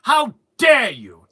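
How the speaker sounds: slow, high, loud theatrical scolding; grandly offended, drawn-out words; mock outrage. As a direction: hurl a hammed-up haughty insult. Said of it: "you can go".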